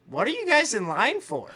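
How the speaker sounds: high, loud amused question, laughing through it; a stranger in the queue asking, laughing. Retold or imitated. Girl in line asks, laughing.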